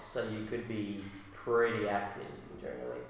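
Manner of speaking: ordinary talk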